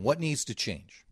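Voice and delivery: ordinary delivery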